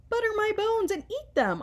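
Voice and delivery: high, loud kid's yell, a bit hammed-up; childish yell. A narrator's reading. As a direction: shout it up high like a scared kid. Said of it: "I yell".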